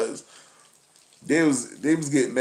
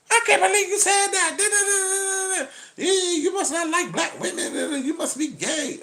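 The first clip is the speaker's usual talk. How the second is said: much higher, outraged woman caricature; high, outraged woman impression; high mocking woman voice. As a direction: squeal it high like an offended woman.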